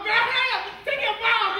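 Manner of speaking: hammed-up panic calling to her; mock-urgent yelling after her. Calling to woman running home.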